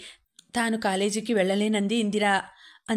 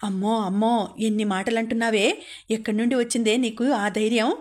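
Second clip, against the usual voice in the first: ordinary delivery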